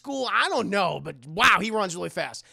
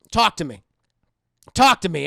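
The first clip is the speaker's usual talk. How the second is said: loud, insistent order to a doll; demanding shout; 3 semitones lower, 10 dB louder than usual. Retold or imitated. Mother orders the doll to talk, loud demand. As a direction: shake the toy and demand it speak.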